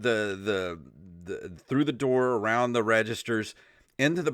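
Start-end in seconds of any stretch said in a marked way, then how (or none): none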